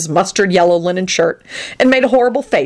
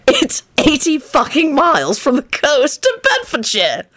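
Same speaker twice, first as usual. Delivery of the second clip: high, slow hilarious disbelief; choked out; 8 semitones higher than usual. Acted. Lupin works out the distance, high choked incredulity. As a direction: draw it out, aghast with mirth. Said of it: "Lupin choked out".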